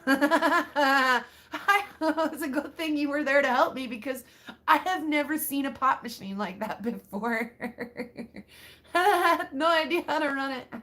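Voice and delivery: ordinary talk, nothing marked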